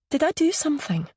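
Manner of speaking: lightly voiced anxious friend; worried question. A narrator's reading.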